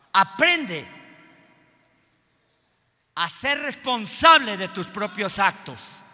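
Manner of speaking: loud preacher rebuke; stern exhortation. Acted. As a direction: command them to take responsibility.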